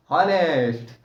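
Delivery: हॉनेस्ट with a sounded H at the start; mispronounced honest. Shown as a demonstration. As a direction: drag out हॉनेस्ट, pronouncing the silent H like a pupil. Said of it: "बहुत बच्चे वाले लोग क्या करेंगे".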